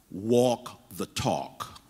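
walk the talk, drawn out slowly with weight on "walk"; slow emphatic phrase. Shown as a demonstration. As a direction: lean hard on "walk" and let "the talk" land slowly. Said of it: "I want to put a tag".

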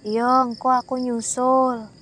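put-on slow, flat, unnatural boy's voice; eerie flat voice. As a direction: say it like someone not quite himself. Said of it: "Nadanya bukan nada orang normal".

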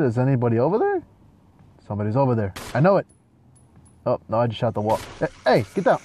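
ordinary delivery

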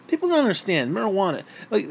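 ordinary delivery

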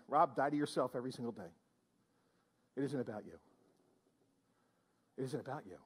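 Jesus softly telling Rob, low and hushed. Acted. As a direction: say it low and soft, close to the ear. Jesus quoted speaking to Rob.

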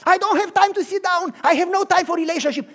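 high, loud rushed refusal; high loud protest; frantic protest. Acted. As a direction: brush off the offer. Busy worker protests.

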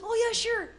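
high, sweet Minnesotan lilt; teasing Minnesotan impression; super sweet Minnesotan accent. Shown as a demonstration. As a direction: round the O's like a Minnesotan. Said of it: "They have that super sweet accent".

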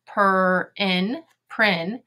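Per, in, then blended prin; teacher tapping out prin; tapping out sounds. Shown as a demonstration. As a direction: sound out prin in separate parts. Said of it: "go ahead and tap it".

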